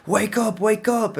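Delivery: high, urgent calling to wake someone; imitating the plug's urgent wake-up call; retold urgent call. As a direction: pitch it up and call him awake. Plug waking him after overdose.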